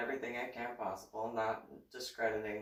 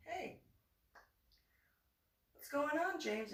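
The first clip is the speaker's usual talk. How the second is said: much higher, bright calling tone; sing-song greeting; 5 dB quieter than usual. Retold or imitated. Mom greeting her son upstairs, high and light. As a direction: call up to him lightly and sweetly.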